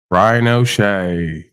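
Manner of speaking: slow, stretched announcer call of the name "Brian O'Shea"; drawn-out name. Acted. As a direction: drag out "Brian O'Shea" like introducing a guest. Host announces a guest.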